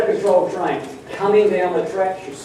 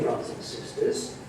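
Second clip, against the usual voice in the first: hushed aside; 13 dB quieter than usual. Real, not acted. Preacher drops voice mid-sentence.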